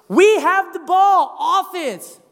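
slow, loud coaching bark; coach shouting. Retold or imitated. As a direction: yell the play across the court. Coach yelling at players.

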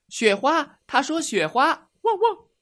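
high, eager little puppy blurting it out; loud puppy voice. Acted. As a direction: call it out eagerly like a keen puppy. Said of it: "站起来大声地说道".

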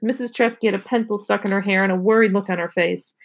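ordinary delivery, nothing marked

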